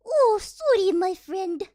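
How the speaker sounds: loud, high mock-Russian apology; hammed-up Russian gamer, pitch jumping up on "sorry". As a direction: exclaim it like a trolling Russian player. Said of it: "they would steal your kill and be like".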